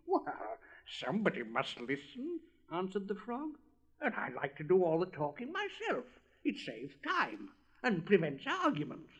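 bubbly, talkative little frog; chatty frog. Acted. Frog's voice.

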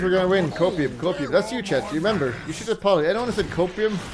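ordinary talk

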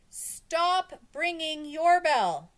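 slow, loud, high holler; crowd shouting. A narrator's reading. Crowd shouts to the teacher ringing her bell, slow holler. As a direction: stretch the words to carry over noise.

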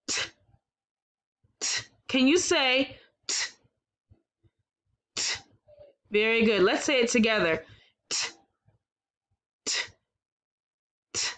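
crisp t sound alone; isolated t sound. Shown as a demonstration. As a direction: say just the t sound clearly. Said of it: "Our new sound is".

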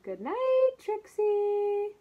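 tender goodnight. Acted. Mommy and daddy tucking Trixie in, tenderly. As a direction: lull her to sleep.

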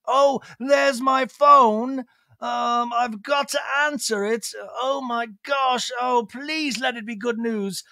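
high, drawn-out theatrical delight; gushing British restaurateur impression. Lisa taking a call from the guys, gushing impression. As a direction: greet the guys in a high put-on gush. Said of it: "we get a FaceTime call".

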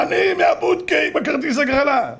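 high-pitched eager announcement; excited visitor. Acted. Lottery seller announcing himself at the door, excited. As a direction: call it out high, bursting with news.

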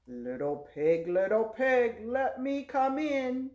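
loud, rising storybook wolf; big wolf voice. Acted. Wolf at the stick house door, loud building demand. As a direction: boom it, stretching the last words. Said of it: "low wolf voice".

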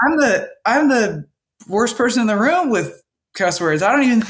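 pitched well up, exasperated retelling; half-laughing retold outrage; indignant protest. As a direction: insist she's the worst for cussing. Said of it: "and I was so mad".

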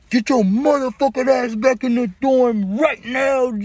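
high, slow, furious shouted order; guard yelling. Retold or imitated. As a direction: bark it like a prison guard ordering inmates back. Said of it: "we don't hear the hudas like".

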